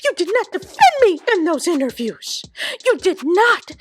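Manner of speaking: very high, whiny hammed-up sobbing plea; mock tearful pleading. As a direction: beg like a wounded friend, overdone. Alandria's tearful accusation, acted.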